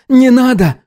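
very high desperate cry; boy's outcry. A narrator's reading. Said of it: "выкрикнул Артур".

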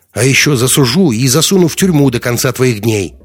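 high, loud vindictive tirade; angry threat. A narrator's reading. Captive threatens to sue and jail.

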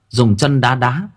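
ordinary delivery

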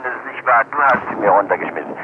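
ordinary talk

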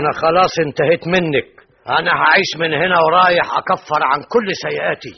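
loud, high final break-off; angry rejection. Acted. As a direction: push her away for good. Rejecting her angrily. (رفض غاضب بصوت عالٍ)

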